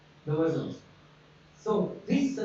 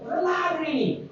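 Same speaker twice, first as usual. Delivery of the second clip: high, fast Egyptian villager shrug; 7 semitones higher, faster than usual. Retold or imitated. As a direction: play a clueless Egyptian villager patient. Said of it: "they speak like this".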